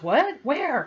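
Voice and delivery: high, loud, bewildered questions; startled questioning. Retold or imitated. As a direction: act out being caught off guard, pitch jumping. Retold startled reply to alarm.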